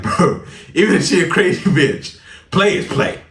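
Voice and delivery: high, loud, comic insistence; hyped-up justification. Retold or imitated. Hyping himself up about the girl, loud mock insistence. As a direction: push it up high like an excited plea. Acting out his excited reasoning.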